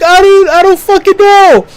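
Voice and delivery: high, overwhelmed reply; shocked outburst. Retold or imitated. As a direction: blurt it out in disbelief. His own shocked reply, retold.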